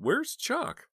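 high, loud anxious question; alarmed. A narrator's reading. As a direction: ask in sudden panic. Alarmed asking after brother.

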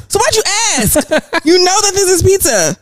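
exasperated parent. Retold or imitated. Parent scolding questioning son.

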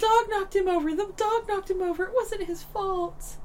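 high-pitched mom defending someone; urgent high protest, easing off in volume; imitated pleading mom. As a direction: plead in a put-on mother's voice. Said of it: "Mom comes around and goes".

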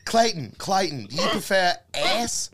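brother's question. Retold or imitated. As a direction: ask it loud and drawn out like the cool older brother. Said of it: "his brother would be like".

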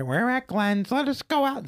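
high, put-on parent voice; imitated parents. Voicing parents on phone at supermarket.

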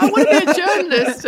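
loud, high, laughing eagerness; loud, high eager young voice, laughing; eager reply. Retold or imitated. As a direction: blurt it out keenly.

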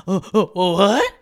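stammering oh oh oh, then a loud rising what; startled disbelief. Retold or imitated. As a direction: caught off guard, blurting confusion. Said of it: "I would just be like".

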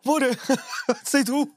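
high, drowsy wife calling out; sleepy wife voice. Acted. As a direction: call out like a half-asleep wife. Wife woken by fart.

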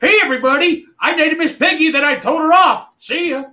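high croaky frog puppet voice; Kermit the Frog voice. Pretend. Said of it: "your Kermit the Frog impersonation".

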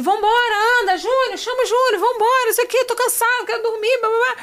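high, impatient mock nagging; singsong high-pitched complaining, rattled off; Marcos. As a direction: imitate a tired man urging everyone to leave. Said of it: "E o Marcos já tá assim".

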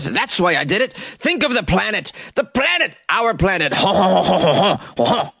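high, hammy French protest; comic French accent with fake throaty laughs; mock French accent. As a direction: rant like a cartoon Frenchman, ending in ha-has. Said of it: "He's yelling in French".

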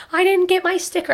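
put-on whiny toddler. Toddler complaining to mom in the car, high whine. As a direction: imitate a pouting three-year-old. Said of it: "we got to the car".

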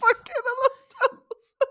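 laughing echo. Pretend. Echoing meme, laughing.

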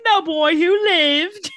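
high, loud, drawn-out mock British; slow theatrical fake British accent; Harry Potter British. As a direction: intone it in hammed-up British. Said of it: "The only thing I know from Harry Potter".

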